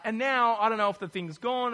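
ordinary talk, nothing marked

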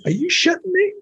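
incredulous. Retold or imitated. His incredulous retort.

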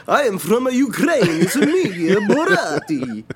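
put-on high, hammy Ukrainian accent. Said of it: "Barati trying to sound like a Ukrainian".

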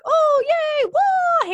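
high, loud whoop of a thrill rider; mock thrill squeal. Fan mocking thrill-ride excitement with a high, loud mock squeal. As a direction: squeal it like riding a coaster, arms up.

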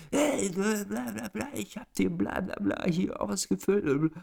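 high-pitched blah-blah imitation; mocked irate official. Storyteller mocking the official storming out of his booth, high mimicry. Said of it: "läuft der Typ raus aus dieser Zelle".

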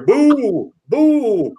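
mock booing. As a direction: boo from the box like a heckler. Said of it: "Booing me".

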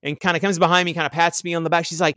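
usual speaking voice

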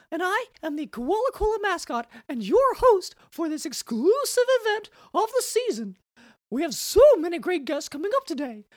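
high, chirpy koala mascot voice; cartoon mascot host. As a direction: play a cheesy corporate mascot host. Mascot character hosting.

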